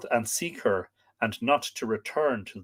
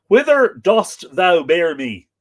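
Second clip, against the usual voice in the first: "Whither dost thou bear me" cried out louder, slow and amazed; amazed maiden; 6 semitones higher, 11 dB louder, slower than usual. Acted. Europa carried off by the bull, amazed. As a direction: gasp it out, slow and awestruck. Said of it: "spoke thus in her amazement".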